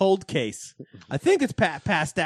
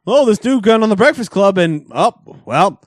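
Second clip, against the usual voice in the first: higher, louder gossipy quote; mock gossip voice; 4 semitones higher, 6 dB louder than usual. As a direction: spill the gossip with excitement.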